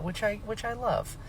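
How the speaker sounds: ordinary delivery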